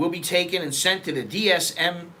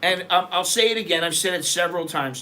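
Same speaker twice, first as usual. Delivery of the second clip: ordinary delivery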